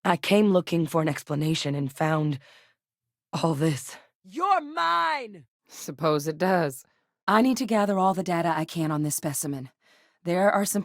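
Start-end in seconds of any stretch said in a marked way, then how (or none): 4.2-5.5 high-pitched combat yell; battle cry. Acted. Fighter taunting an enemy in combat, high battle cry. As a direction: call out fiercely, pitch leaping up.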